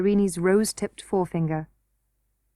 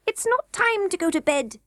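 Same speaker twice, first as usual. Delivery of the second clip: high, fussy goblin queen voice; a greedy queen eyeing fresh meat; 9 semitones higher than usual. A narrator's reading. Goblin queen to the king, high and fussy.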